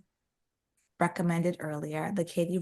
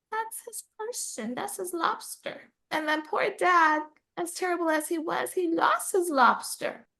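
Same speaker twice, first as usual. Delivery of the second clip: teary, moved; 11 semitones higher than usual. Retold or imitated. Reader reliving the dad losing his lobster, teary and high. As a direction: recount it tenderly, pitched up as if welling up. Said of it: "a little bit watery at that point".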